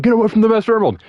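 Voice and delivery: high, loud, rapid warning; pissed off. Acted. Knuckles guarding the Master Emerald, pissed off. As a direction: yell it fast in acted rage. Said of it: "get really pissed off and be like".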